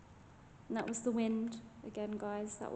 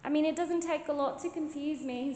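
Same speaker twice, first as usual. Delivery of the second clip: ordinary delivery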